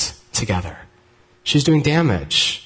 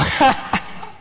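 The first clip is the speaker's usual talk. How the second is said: light laugh; 10 semitones higher than usual. Real, not acted. Laughing at own joke.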